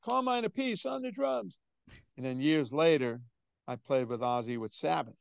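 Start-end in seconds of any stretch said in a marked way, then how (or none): 0.1-1.6 high-pitched concert announcer impression. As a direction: call out the drummer to the crowd, high and drawn-up. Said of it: "[name] would introduce him".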